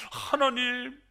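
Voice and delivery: high, wailing plea through tears; crying prayer. Acted. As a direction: cry out to God in grief. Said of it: "울면서 기도합니다".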